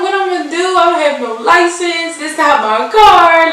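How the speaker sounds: replaying her own scared outburst, pitched high; panicked. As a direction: voice climbs in a panic. Panicking after hitting the car.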